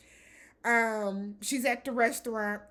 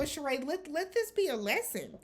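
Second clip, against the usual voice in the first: ordinary talk, nothing marked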